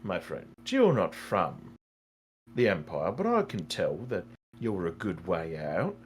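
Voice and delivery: low, measured voice of counsel; concerned advisor Arno. Acted. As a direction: gently caution a foolish gambler.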